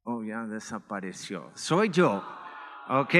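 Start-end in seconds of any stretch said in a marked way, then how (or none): none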